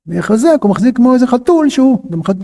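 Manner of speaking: usual speaking voice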